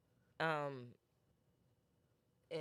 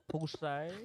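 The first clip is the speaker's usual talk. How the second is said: put-on French accent. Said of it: "B trying to do a French accent".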